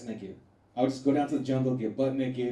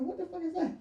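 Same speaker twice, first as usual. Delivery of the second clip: high, softer startled question; 13 semitones higher, 6 dB quieter than usual. Retold or imitated. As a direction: ask it in sudden alarm. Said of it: "I was like".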